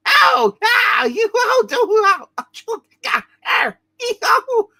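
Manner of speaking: loud, high-pitched pained yowls; a string of loud comic yelps. Acted. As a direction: cry out sharply as if being struck. Figure in the story gets hurt.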